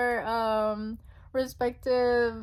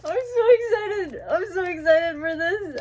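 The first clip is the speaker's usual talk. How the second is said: higher and louder, near a shout; excited; 4 semitones higher, 4 dB louder than usual. Real, not acted. Fan about to open the album, crying out with excitement. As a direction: burst with excitement.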